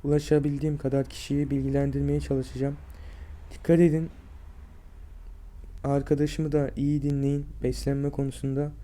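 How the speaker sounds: usual speaking voice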